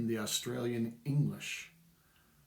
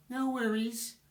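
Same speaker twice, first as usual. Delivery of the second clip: high, airy "No worries" in a put-on voice; mock breezy reply; 12 semitones higher than usual. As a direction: toss it off like a chirpy clerk. Acting out bored replies.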